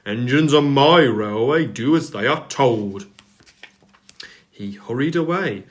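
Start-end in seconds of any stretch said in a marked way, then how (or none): 0.1-3.1 loud, firm scolding; the Fat Controller scolding the engines, loud and severe. A narrator's reading. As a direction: lay down the law loudly. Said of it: "said the Fat Controller severely".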